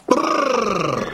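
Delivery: lips trilled prrr to stop the horse; rolled brr to halt horse. Acted. Reining in the horse. (протяжное «тпру» коню)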